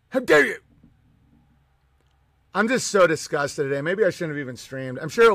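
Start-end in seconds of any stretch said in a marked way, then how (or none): 0.1-0.6 high, indignant mock scold; mock outrage. Streamer mocking an outraged fan, hammed-up scold. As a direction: ham up the offended gasp.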